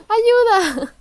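loud high hammed-up cry for help; mock cry for help. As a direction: yell for help as a joke. Player calling for help.

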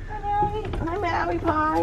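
high, soft, slow pet talk; cooing at pet. Real, not acted. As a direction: coo at a cat.

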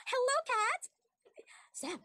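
ordinary talk

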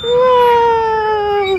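long, high, loud swooping whoa; excited whoa. Real, not acted. Mom cheers toddler swinging, long high whoa.

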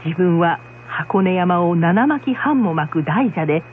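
normal talking voice

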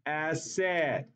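"acid" drawn out as ASS-SED; acid rhymed with red. Shown as a demonstration. Teacher completing the rule for students, "acid" rhymed with red. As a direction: give "acid" a long "-ed" ending, like "said". Repeats the taught rhyme trick.

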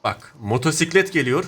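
ordinary talk, nothing marked

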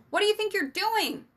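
high, loud challenging shout; reflexive yell. A narrator's reading. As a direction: yell it at someone caught in the act. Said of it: "Sam yelled out reflexively".

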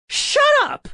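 high, loud, furious shout; explosive high-pitched outburst; angry yell. A narrator's reading. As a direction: yell to cut them off. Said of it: "I yelled".

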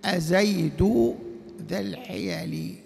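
drawn out, word by word; slow example reading. Shown as a demonstration. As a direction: read the example slowly for the class. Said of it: "وجاب المثال".

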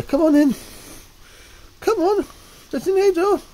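high, loud call to the cat; loud high-pitched calling. Real, not acted.

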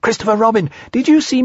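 put-on high squeaky child showing off to everyone; excited squeak. A narrator's reading. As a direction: squeak each name, begging to be noticed. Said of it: "squeaked Roo excitedly".

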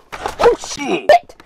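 loud, startled outburst; startled swearing. Real, not acted. Vlogger cracking something open, startled and swearing loudly.

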